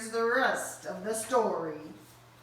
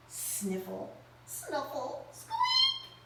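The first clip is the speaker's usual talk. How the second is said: tiny creature noises; sniffle and squeak; slower than usual. Acted.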